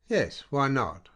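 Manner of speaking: a capricious, slightly petulant, slow insistence; slight petulance. A narrator's reading. Woman pressing her lover for a tale, slightly petulant. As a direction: press the point with a loved woman's whim. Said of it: "These words came with a slight petulance".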